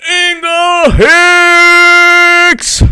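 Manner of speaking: high, stretched-out name call; excited shout-out. Real, not acted. Host greets a loyal viewer, high excited call. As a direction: sing out the name high and long.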